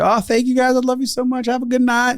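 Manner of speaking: very high, sweet, gushing thanks; gushing waitress. Retold or imitated. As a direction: beam your thanks like a delighted waitress. Waitress thanking them, voiced.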